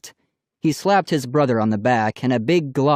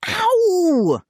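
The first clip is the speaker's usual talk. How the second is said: high yelp; 3 dB louder than usual. A narrator's reading. Finger snapped at nose.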